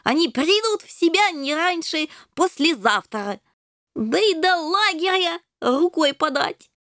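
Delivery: high-pitched breezy kitten voice; carefree kitten. A narrator's reading. Ginger reassuring friend that bandits won't come, carefree high voice. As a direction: brush off the danger cheerfully.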